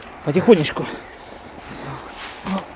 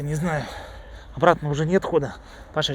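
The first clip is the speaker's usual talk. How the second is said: normal talking voice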